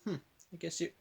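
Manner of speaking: soft, musing aside; hushed, pensive mock reaction. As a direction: mull it over quietly, as if imitating someone. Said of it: "just immediately goes".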